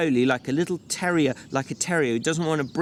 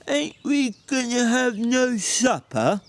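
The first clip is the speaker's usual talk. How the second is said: slow, higher simple-minded big man; Lenny's slow childlike voice; 9 semitones higher, slower than usual. A narrator's reading. Lenny asking George about supper, slow and childlike. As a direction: plead like a slow, simple giant.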